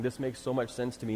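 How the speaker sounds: ordinary delivery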